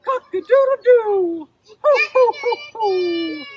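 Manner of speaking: high, loud rooster crow. Pretend. Animal sounds for kids.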